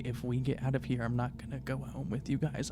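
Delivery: ordinary talk, nothing marked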